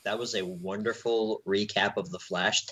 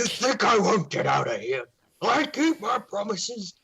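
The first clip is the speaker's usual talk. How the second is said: put-on villain voice, pitched up; bad villain impression; 8 semitones higher than usual. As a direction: threaten like a hammy TV villain. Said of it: "All right, that wasn't very good".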